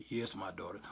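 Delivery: deep, hushed, solemn God voice. Pretend. Comedian answering as God, deep and hushed. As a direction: intone it like a movie God. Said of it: "Why God always got to have that voice?".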